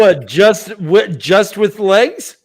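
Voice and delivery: high, slow disbelieving laugh; incredulous laughing. Real, not acted.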